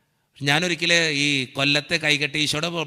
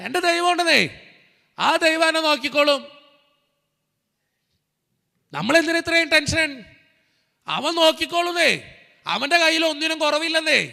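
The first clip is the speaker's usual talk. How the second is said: high, loud, sustained exhortation; impassioned revival preacher at full pitch; full-force preaching; 11 semitones higher, 8 dB louder than usual. Real, not acted. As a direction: cry out each line to rouse the congregation.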